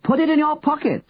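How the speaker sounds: high, carrying call; very high-pitched shout, volume held back. A narrator's reading. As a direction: call down from a balcony. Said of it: "Henry shouted".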